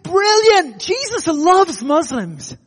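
high, loud, delighted exclamation; retold blurted enthusiasm. Pastor blurting an answer to his challenger, enthusiastic. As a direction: burst out with it, stretching the words. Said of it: "it just popped out of me".

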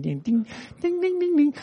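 soft little dings climbing high; imitated UFO noise. Preacher joking that alien righteousness isn't UFOs, imitated spaceship dings. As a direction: mimic a spaceship's high, quiet dinging. Mimics UFO sounds as a joke.

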